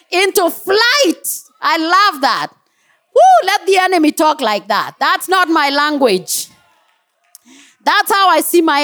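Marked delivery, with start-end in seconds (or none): 3.1-3.5 high, loud whoop of delight; excited whoop. Real, not acted. Preacher whooping at the enemy's panic, delighted. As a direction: let out a joyful holler.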